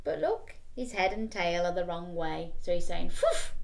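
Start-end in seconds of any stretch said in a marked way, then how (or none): 3.1-3.5 squeaky high phew; high phew bark. Shown as a demonstration. Reader shows the backwards bark, very high. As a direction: pop it out high. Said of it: "Can you say it?".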